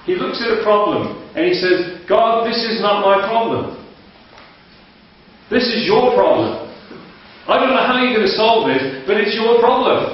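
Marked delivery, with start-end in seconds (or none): 2.1-10.1 raised, earnest plea to God, lightly voiced; a hint of the Indian pastor praying; imitating a pastor's prayer. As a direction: hand the problem over to God, pitched up. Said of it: "he's got a lovely way of praying".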